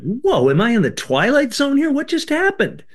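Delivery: high, astonished inner voice; stunned surprise. Retold or imitated. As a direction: gasp at the strangeness. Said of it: "And I'm thinking, like".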